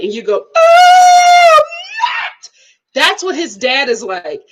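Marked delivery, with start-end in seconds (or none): 0.5-2.5 very high, loud exaggerated wail; mock bawling. Child breaking down to mom, hammed-up wail. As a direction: burst into a hammed-up sob.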